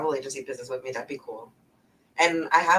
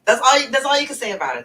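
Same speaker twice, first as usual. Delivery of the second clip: excited; 10 semitones higher, 10 dB louder, faster than usual. Real, not acted. Emphatic point about H-Town accent.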